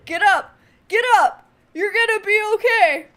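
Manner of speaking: loud, high call to get up; shouting at the fallen companion to get up; urgent shout. Acted. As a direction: yell at him to get up. Urging fallen companion to rise.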